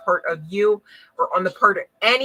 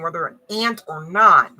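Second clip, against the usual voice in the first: ordinary talk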